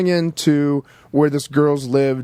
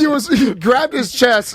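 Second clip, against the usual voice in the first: ordinary delivery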